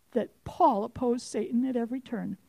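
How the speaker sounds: whispered like a secret. Acted. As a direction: lean in and whisper it. Revealing a hidden point.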